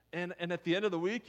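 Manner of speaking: normal talking voice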